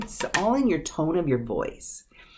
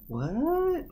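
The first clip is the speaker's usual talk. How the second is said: taken aback; 8 semitones higher, 5 dB quieter than usual. Shown as a demonstration. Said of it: "we're like".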